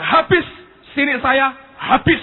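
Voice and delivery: loud, high, emphatic exclamation; mimicking an over-eager storyteller; imitated enthusiasm. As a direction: hammer the word out like thrilling news. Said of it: "Om ini cerita dengan semangat".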